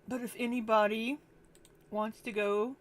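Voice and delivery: talking between hiccups; quiet, hiccup-broken speech. Real, not acted. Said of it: "Sorry, I'm hiccuping".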